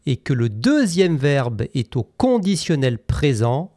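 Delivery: ordinary delivery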